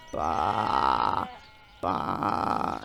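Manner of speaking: very low, tired mock sheep bleat, fading to breath. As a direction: imitate a groggy sheep, low and dragging. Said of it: "that's how I'd sound".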